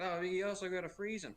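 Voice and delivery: lightly voiced nagging wife over the phone. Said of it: "she goes".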